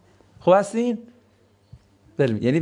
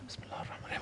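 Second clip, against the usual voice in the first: barely voiced mumble, imitating a shy speaker; mock the embarrassed whisper of the opening; mumbled under breath; 23 dB quieter, much faster than usual. Mocking someone too shy to say it aloud, whispered.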